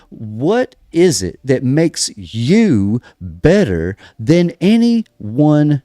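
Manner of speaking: normal talking voice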